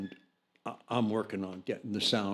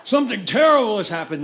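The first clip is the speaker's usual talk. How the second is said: high, loud, hammed-up alarm; milk the bad news for the band; overdramatic; 10 semitones higher, 12 dB louder, faster than usual. Jack repeating the news loudly for the band, overdramatic. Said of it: "he's being overdramatic".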